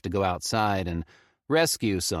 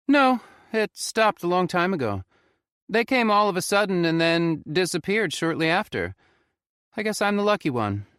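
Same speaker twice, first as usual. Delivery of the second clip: higher put-on voice for the stony old man; grizzled old man Greg; 11 semitones higher, 5 dB louder than usual. Old man Greg tells the narrator the calls stopped, higher voice.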